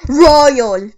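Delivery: loud, low call of a name; low-pitched, loud summons. Real, not acted. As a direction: call the teammate's name firmly, dropping in pitch. Calling out to a teammate.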